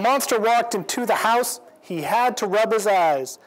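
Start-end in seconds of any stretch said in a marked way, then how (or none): none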